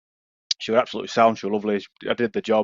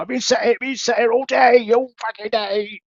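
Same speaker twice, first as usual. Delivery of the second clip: put-on London accent; 11 semitones higher than usual. Bloke complains to the driver, mock London accent. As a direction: drag out the grievance in mock London. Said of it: "I'm not gonna do accent".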